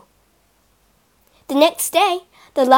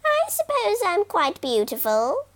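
high, self-satisfied crow; vain crow voice; 8 semitones higher, 4 dB quieter than usual. Acted. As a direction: preen as you say it. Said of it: "she thought".

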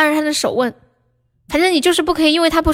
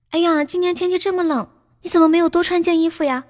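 caring, concerned tone; 5 semitones higher than usual. Shown as a demonstration. Reminding a partner to dress warmer, caringly. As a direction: show concern for an underdressed partner. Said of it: "用关心的这种语气说".

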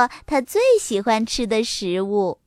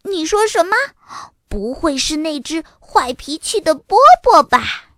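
high, louder shocked question; startled disbelief; 5 semitones higher, 5 dB louder than usual. A narrator's reading. Sister hears which dog is coming, startled. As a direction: react in alarmed surprise. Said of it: "多拉瞪大眼睛说".

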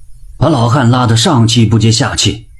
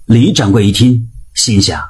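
usual speaking voice

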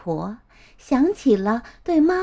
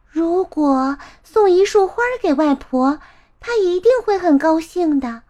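put-on higher little-girl voice, eager and lilting; 5 semitones higher, 3 dB louder than usual. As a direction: think aloud as an eager child. Girl thinks aloud.

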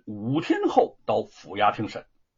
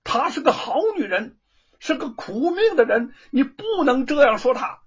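higher and louder, defending her hotly; angry retort; 10 semitones higher, 5 dB louder than usual. Acted. Yao defends the woman he loves, angry and loud. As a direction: snap back in her defense. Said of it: "怒道".

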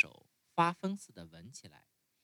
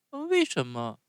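高一点的童声，好奇地追问; 像小孩那样问为什么; 木偶孩子的声音; 5 semitones higher, 6 dB louder than usual. Acted. Narrator voices Pinocchio. (用稍高的童声好奇发问)

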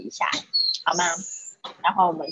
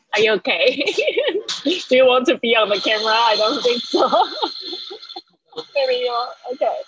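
playful coaxing; 4 semitones higher, 3 dB louder than usual. Real, not acted. Talking to a child at home.

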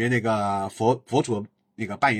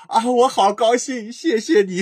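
overjoyed thanks; 10 semitones higher, 6 dB louder, slower than usual. Retold or imitated. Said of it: "然后他就说".

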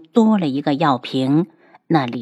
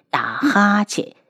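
plain reading voice

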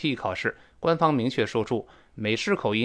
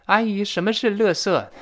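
put-on child's question; 8 semitones higher, 4 dB louder than usual. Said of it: "那我儿子就问".

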